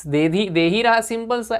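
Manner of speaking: ordinary talk, nothing marked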